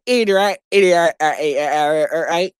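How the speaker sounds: imitating a warped alien voice. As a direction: do a glitchy alien voice. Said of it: "that alien voice".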